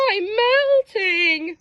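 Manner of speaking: high, slow pleading; high desperate plea. Acted. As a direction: plead as it melts. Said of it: "pleaded Lolly".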